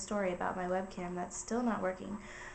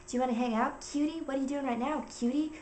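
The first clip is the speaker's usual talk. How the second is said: higher, singsong mock flirting; flirty texter; 5 semitones higher than usual. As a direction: mimic a pushy flirt, lifting the pitch on each pet name. Creepy guy's flirty texts read out.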